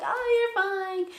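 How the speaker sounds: high, drawn-out imitation of sister; dismissive sister. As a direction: brush it off in a slow, high voice. Imitates sister brushing worries off.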